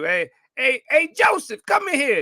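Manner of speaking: high, loud call across the house; a mother summoning her son from his room; mom calling out. Retold or imitated. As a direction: holler a child's name from another room.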